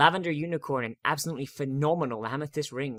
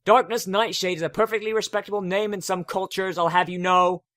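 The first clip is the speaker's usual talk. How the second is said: calling after him; 6 semitones higher, 7 dB louder than usual. A narrator's reading. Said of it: "I call on after him".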